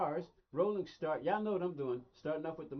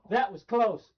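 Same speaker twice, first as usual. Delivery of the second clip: much louder, higher exclamation; relieved exclamation; 5 semitones higher, 12 dB louder, slower than usual. Real, not acted. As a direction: burst out loudly after a near miss. Near miss as race starts.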